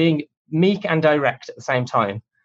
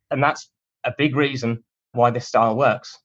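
ordinary talk

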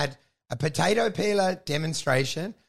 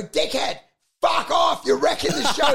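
much higher, loud shouting; angry yell; 7 semitones higher, 4 dB louder than usual. Retold or imitated. Comedian shouting at the pie man wrecking the show. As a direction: bark him away. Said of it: "And then I go".

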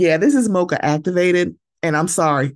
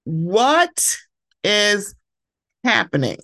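stunned disbelief; 3 semitones higher, much slower than usual. Real, not acted. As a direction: stretch each word in shock. Outraged reaction to covering mic.

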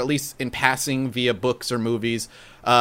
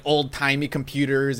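usual speaking voice

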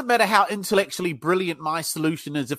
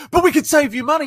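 mock salesman's pitch, higher and louder; imitating a pushy salesman; 8 semitones higher, 6 dB louder than usual. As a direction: jump in like an eager salesman.

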